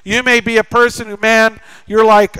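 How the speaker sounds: normal talking voice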